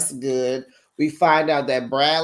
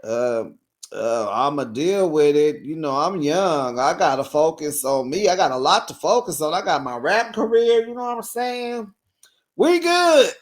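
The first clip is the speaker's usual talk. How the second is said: hammed-up carefree young rapper. [name] telling production about being a father, carefree and hammed-up. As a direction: shrug it off like fatherhood is no big deal. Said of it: "Here he go".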